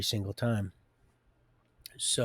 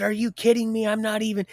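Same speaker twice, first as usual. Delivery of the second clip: high, loud indignant protest; indignant outburst; 10 semitones higher, 6 dB louder than usual. Retold or imitated. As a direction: blurt it out as if insulted. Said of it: "my pride and ego gets, just wells right up".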